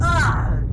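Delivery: loud, high yelp of fright; short frightened shout; startled scream. Real, not acted. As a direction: yelp at a sudden scare. Jump scare.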